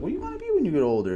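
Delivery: high fast quoting adults; high quoted adult. Retold or imitated. Adults asking kid question.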